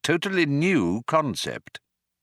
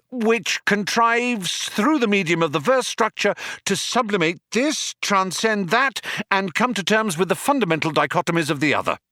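building triumphant crescendo; 12 semitones higher than usual. Acted. Arthur builds his bluffing praise to a crescendo. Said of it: "reaching a triumphant crescendo".

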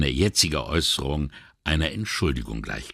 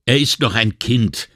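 louder, indignant repeat; 5 semitones higher, 5 dB louder than usual. A narrator's reading. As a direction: throw the words back, outraged. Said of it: "wiederholte der Inspektor empört".

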